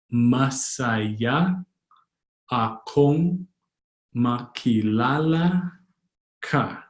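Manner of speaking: each word stretched, pause after each; slow, word by word. Shown as a demonstration. Teacher introducing a Tagalog greeting, slowly word by word. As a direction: sound out "Masaya akong makilala ka" in pieces.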